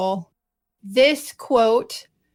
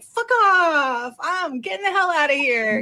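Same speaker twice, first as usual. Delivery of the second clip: imagined defiant kiss-off; 4 semitones higher than usual. Retold or imitated. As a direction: act out a gleeful inner kiss-off. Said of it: "But inside, I was like".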